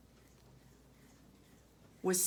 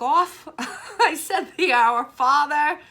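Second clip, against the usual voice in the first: laughing; 7 semitones higher, 11 dB louder, slower than usual. Real, not acted. Laughing at own story.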